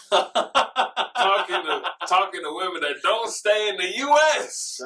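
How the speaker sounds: laughing while bragging; words squeezed out through a laugh. Real, not acted. Bragging to his friend, laughing through the words.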